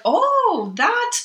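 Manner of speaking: high-pitched, drawn-out oh of discovery; surprised realization. Retold or imitated. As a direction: recall a sudden eyebrow-raising revelation. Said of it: "my eyebrows went up".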